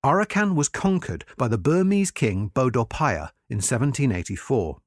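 usual speaking voice

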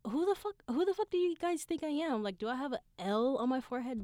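high-pitched indignant questioning; mock indignation. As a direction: pitch it way up, affronted.

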